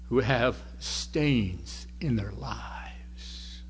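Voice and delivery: slow, hushed whisper. Real, not acted. Preacher telling the congregation about hidden stains, slow whisper. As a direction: drop to a slow whisper to draw them in.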